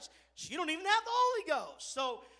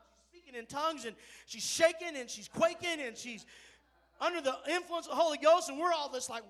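ordinary talk, nothing marked

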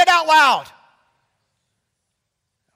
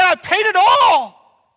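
loud, slow, triumphant declaration; ringing cry of victory; Jesus proclaiming; 6 semitones higher, 8 dB louder, slower than usual. Acted. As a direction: proclaim it from the cross. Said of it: "he said".

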